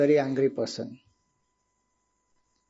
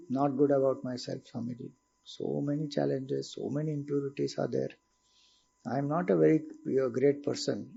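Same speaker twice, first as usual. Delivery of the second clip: dejected devotee; 4 semitones lower, 10 dB quieter than usual. Retold or imitated. Student confessing to Swamiji after introspection, softer and downcast. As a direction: sigh it out as a disheartened student.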